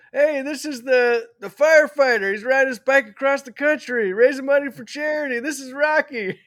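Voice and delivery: a big booming announcement; announcing to the room. Retold or imitated. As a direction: call it out so the whole restaurant hears. Man announcing to the whole restaurant.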